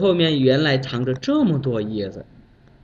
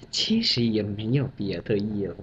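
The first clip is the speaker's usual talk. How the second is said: lower, quiet deferential voice; humble subordinate; 6 semitones lower, 6 dB quieter than usual. Retold or imitated. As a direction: say it modestly and low. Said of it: "小张说：".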